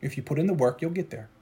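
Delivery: quiet, steady promise; soft reassurance. Acted. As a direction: reassure them gently.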